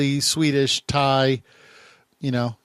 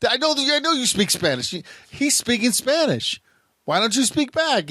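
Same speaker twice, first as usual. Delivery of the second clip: high, exasperated quoting of himself; retelling his own exasperated plea; mock frustrated voice; 11 semitones higher than usual. To the men ignoring him, a mock exasperated plea. Frustrated at non-responsive Spanish speaker.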